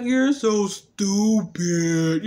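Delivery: very slow, drawn-out woozy speech; talking funny from gas. Retold or imitated. Gassed woman insulting her partner, talking funny and slow. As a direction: drag each word out as if gassed. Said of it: "they started to talk funny".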